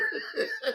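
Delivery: high-pitched squeal of laughter. Real, not acted. Streamer recalling the roast, squealing with laughter. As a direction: let a shrill laugh burst out.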